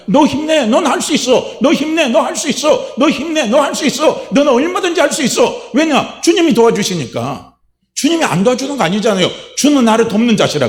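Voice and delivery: ringing pulpit cry; fervent shout of encouragement. Acted. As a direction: urge yourself on at full preaching force.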